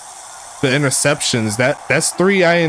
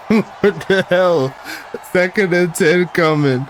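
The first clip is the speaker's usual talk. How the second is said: stunned; 5 semitones higher, slower than usual. Real, not acted. Disbelief after the turnovers.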